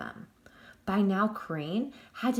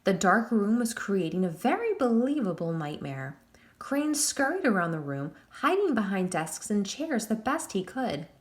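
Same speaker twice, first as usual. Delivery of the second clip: ordinary delivery, nothing marked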